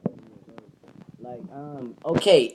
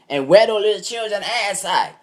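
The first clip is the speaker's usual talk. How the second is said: higher and louder, hammed-up threat; mock threat; 10 semitones higher, 13 dB louder than usual. Comforting his cousin by threatening the bullies, mock loud threat. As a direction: play the protective big cousin going off.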